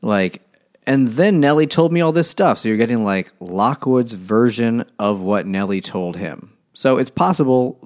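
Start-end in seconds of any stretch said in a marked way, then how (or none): 0.9-2.6 higher, louder mock narrator voice; mock Lockwood. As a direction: mimic a prissy gentleman. Mimicking Lockwood retelling Nellie.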